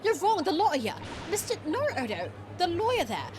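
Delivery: hammed-up high-pitched objection; indignant protest. Acts protesting game character.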